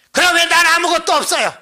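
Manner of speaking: high, loud, aggrieved outburst; angry complaint. Retold or imitated. Deacon angry at the pastor for receiving nothing, high loud complaint. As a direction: imitate the deacon venting at the pastor. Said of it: "저한테 화를 내".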